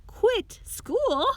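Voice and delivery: high, startled disbelief; shocked cry. A narrator's reading. Rags reacting to Jackie quitting school, shocked. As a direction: jump up high in dismay at the news. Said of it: "cried Rags".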